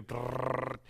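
rolled "brrrr" like automatic gunfire; machine-gun trill. Retold or imitated. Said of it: "it sounds like an A K forty-seven".

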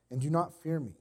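usual speaking voice

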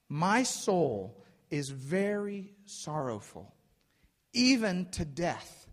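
slow, weighty sorrow, acted out; preacher voicing slow, troubled grief; greatly distressed. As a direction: draw out each phrase in mild anguish. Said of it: "greatly distressed and troubled".

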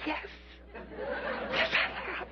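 small, soft embarrassed reply; sheepish admission. Acted. Peter answering his jeering buddies, sheepish and quiet. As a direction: mumble it back, ashamed.

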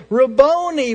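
loud, high cry of recognition; Mary crying out to Jesus. Retold or imitated. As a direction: cry out to the beloved teacher. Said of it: "she cried out in Aramaic".

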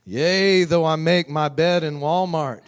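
booming King James preacher voice, laughing; loud, high, solemn scripture parody; mock biblical prophet. Parody of Psalm 23 about Walmart.

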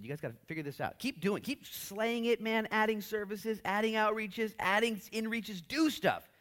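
high, loud encouragement; egging Jesus. Retold or imitated. Jesus urging the church on, lightly voiced. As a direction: cheer them on.

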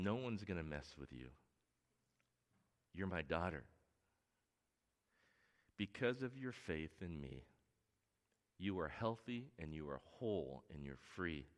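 soft, low and gentle; tender reassurance. Acted. Jesus to the frightened woman, gently reassuring. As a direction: comfort her, she's safe now.

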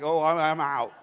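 low, loud mock-groggy voice; fake sleeping. Husband dodging crying baby, low hammed-up fake sleep. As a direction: pretend to be out cold, hammed-up. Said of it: "your husband's fake sleeping like".

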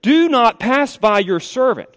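slow, high, earnest entreaty; acted begging from a scripture reading; begging plea. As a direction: draw it out as a respectful plea. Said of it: "notice how he begs him here".

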